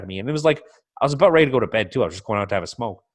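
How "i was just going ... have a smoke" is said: ordinary talk, nothing marked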